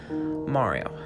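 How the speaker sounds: plain reading voice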